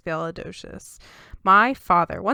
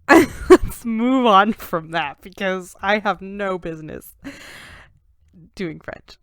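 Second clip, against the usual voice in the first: laughing; 6 dB louder, slower than usual. Real, not acted. Laughing at own French.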